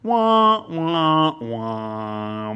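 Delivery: descending mock wah-wah-wah; sad trombone. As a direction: sing a falling sad trombone. Mock sad trombone after verse.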